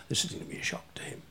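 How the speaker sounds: quick whispered aside. Real, not acted.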